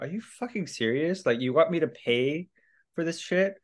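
high, outraged disbelief; mock outrage at the bill; indignant disbelief. Handed the jail bill, indignant disbelief.

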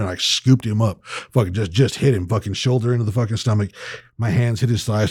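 usual speaking voice

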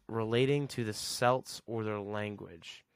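ordinary talk, nothing marked

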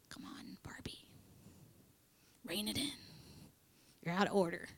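whispered imitation of a dad; hushed scolding. Dad correcting his child in the front row, whispered scolding. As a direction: scold under your breath, as if in church.

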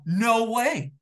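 high, loud disbelieving no way; stunned disbelief. Retold or imitated. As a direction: gasp it out in shock. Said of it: "the first words out of my mouth".

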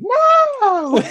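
very high, loud squeal; Rodney squeals in shock at Cassie's praise; squeaky high pitched. Retold or imitated. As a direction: shriek it way up like a soprano. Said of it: "laying it on thick this time".

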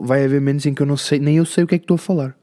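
normal talking voice

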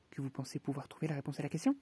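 fast, odd-sounding hare voice; quick March Hare. Acted. The March Hare pressing Alice, rapid and twitchy. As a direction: rattle it off like a jittery hare.

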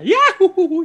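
high cartoon falling holler; Goofy yell. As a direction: yodel it like a cartoon dog falling. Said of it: "cuando él va cayendo de la montaña".

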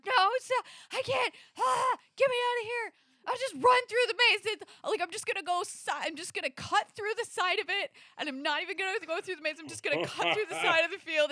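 high-pitched frantic pleading; hysterical, high mock panic, babbling; retold panic. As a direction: re-enact freaking out and bargaining for an escape. Acts out her panic in maze.

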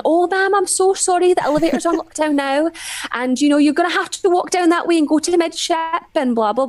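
high sweet Scottish customer service voice. Retold or imitated. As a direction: chirp it like a polite Scottish clerk. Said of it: "trying to put her customer service voice on".

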